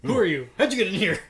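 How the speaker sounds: loud, high startled challenge; mock alarm. Host jokingly confronting cohost as an intruder, loud mock alarm. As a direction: pretend to catch an intruder.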